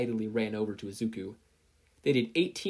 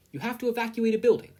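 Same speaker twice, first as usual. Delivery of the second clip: high, loud, fast quiz call; brisk drill call; 11 semitones higher, 6 dB louder, faster than usual. Acted. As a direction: fire off the card like a drill sergeant. Drill card read fast.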